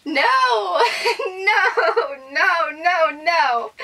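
loud, high, half laughing, half crying; laughing screams. Real, not acted. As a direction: scream no in thrilled disbelief. Overwhelmed screaming at gift.

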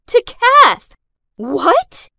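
loud, high exclamation; appalled, incredulous cry; shocked outrage. A narrator's reading. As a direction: burst out in disbelief. Shocked at learning of the wedding.

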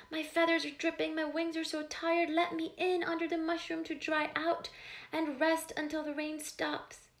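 high, fast pleading bird voice; crying sparrow. Acted. The sparrow begging the animals for shelter, high and pleading. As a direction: plead like a soaked tired little bird.

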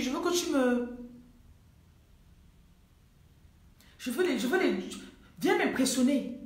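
mock a client pestering the coach to impress; pushy, high-pitched whining demand, acted out. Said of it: "les Africains qui aiment qu'on les blase".

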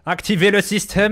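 hammed-up German accent commanding the doctor. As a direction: bark the order like a German officer. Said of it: "je prends un accent allemand".

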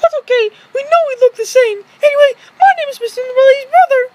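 very high put-on toy voice; Mister Lady voice. Mister Lady greets the viewers, very high. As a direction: squeak it like a tiny toy.

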